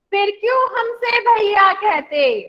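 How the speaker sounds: slow, sing-song poem reading; teacher leading a class poem reading; poem recitation. Shown as a demonstration. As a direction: recite slowly so the class can follow. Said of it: "बोलना है साथ में".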